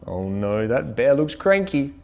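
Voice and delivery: plain reading voice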